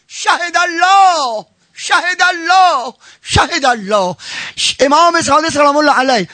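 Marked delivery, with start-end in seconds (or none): 0.1-2.9 high and very slow, stretching each word; slow chanted God-is-witness; drawn-out invocation. Acted. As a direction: intone it like a solemn oath.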